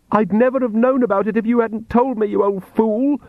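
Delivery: high-pitched angry outburst; mild scream. A narrator's reading. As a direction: scream it without much volume, pitch way up. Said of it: "screamed the girl".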